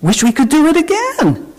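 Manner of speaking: put-on very high, boastful child's voice; bragging. A narrator's reading. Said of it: "Ruthie bragged".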